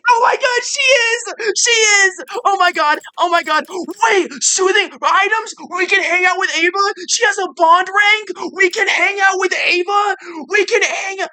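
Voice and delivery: real ecstatic screaming, very high and loud; freaking out. Real, not acted. Player discovers favorite villain has joined the team, screaming ecstatically. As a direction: shriek with disbelieving joy. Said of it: "you missed me freaking out".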